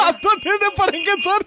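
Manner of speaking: high words shaken by giggles; helpless laughter. Real, not acted. Prank caller telling customer to pay, laughing helplessly. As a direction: insist through a laughing fit. Teasing victim while cracking up.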